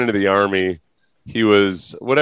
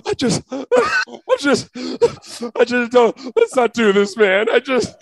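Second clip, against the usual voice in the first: mock weeping; 14 semitones higher, slower than usual. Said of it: "Just openly weeping".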